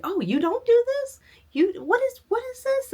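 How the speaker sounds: high, surprised squeal of disbelief; mock surprise. Mom amazed grandma doesn't crochet, high surprised squeal. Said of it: "the mom was like".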